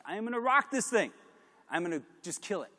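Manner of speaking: high, pumped-up boast; cocky self-hype. Retold or imitated. To himself before the pitch, a pumped-up boast. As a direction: hype yourself up before the meeting. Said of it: "I was like".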